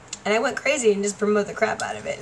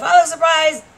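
playful exclamation; 6 semitones higher, 6 dB louder than usual. Real, not acted. Joking about surprised look.

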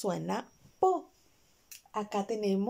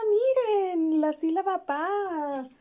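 high, loud happy discovery; excited find; 8 semitones higher, 4 dB louder, slower than usual. Real, not acted. Teacher finding the card, excited and high for the kids. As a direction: show the class the find.

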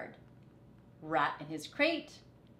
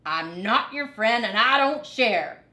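louder, huffy refusal; indignant huffy rat; in a huff; 3 semitones higher, 10 dB louder than usual. A narrator's reading. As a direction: huff it out rudely. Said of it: "said Rat in a huff".

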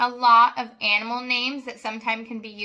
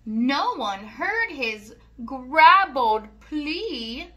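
sing-song rhyming read-aloud; reading to children; 5 dB louder than usual. A narrator's reading. Reading a rhyming farm book to children, sing-song. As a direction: lilt each rhyme for little listeners.

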